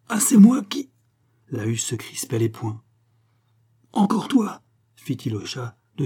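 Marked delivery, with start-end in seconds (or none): 0.1-0.9 high, loud indignant cry; indignant outburst. A narrator's reading. Quarrel escalates, husband protests again. 3.9-4.6 high, exasperated cry; angry exclamation. A narrator's reading. Husband yells at the cat.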